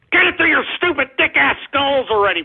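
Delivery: high-pitched angry outburst; exasperated rant. Real, not acted. Host setting name-calling trolls straight, exasperated and angry.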